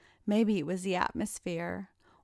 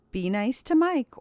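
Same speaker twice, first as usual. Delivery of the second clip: higher, louder command; giving an order; 5 semitones higher, 6 dB louder than usual. A narrator's reading. Karen tells Jack to be nice, ordering. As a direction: firmly tell someone off, raising the voice. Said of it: "ordered Karen".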